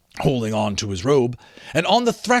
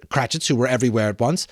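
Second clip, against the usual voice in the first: ordinary delivery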